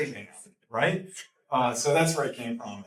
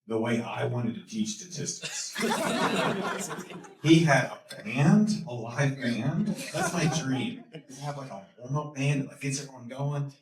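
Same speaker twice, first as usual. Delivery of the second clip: ordinary talk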